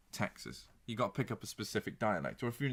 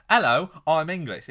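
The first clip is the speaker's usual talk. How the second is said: loud, higher-pitched mock English accent; generic English accent; 6 semitones higher, 12 dB louder than usual. An American attempting an Englishman, hammed-up English accent. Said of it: "Do an English accent".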